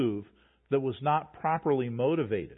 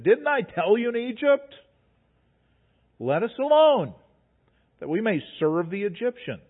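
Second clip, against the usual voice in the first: higher, louder whiny accusation; complaining Israelites; 6 semitones higher, 5 dB louder than usual. Acted. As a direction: throw the blame back at Moses.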